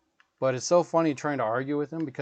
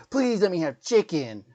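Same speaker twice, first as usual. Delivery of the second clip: higher, louder sweet-voiced plea; mock polite kid; 6 semitones higher, 5 dB louder than usual. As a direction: beg nicely like a little boy. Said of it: "not freaking, but".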